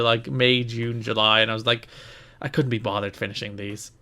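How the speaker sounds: ordinary delivery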